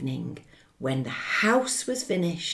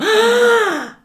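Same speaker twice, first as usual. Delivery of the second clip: shocked gasp; 11 dB louder than usual. A narrator's reading. House blown down.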